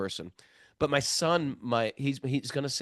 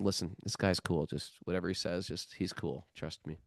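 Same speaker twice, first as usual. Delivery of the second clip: much softer, fading to a near whisper; hushed warning; 8 semitones lower, 9 dB quieter than usual. Retold or imitated. Father quietly prepping his son before the devotee arrives, hushed and fading. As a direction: lean in and hush it to your son.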